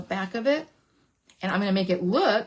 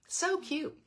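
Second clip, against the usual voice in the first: higher; 8 semitones higher, 7 dB quieter than usual. Real, not acted. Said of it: "second so cute, higher, softer".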